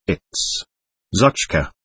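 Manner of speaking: hushed, halting, faintly overcome; voice breaking. A narrator's reading. Sick boy recognises his lost dog, voice mildly breaking with joy. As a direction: let it out softly and haltingly, understated. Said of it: "in a voice breaking with joy and suffering".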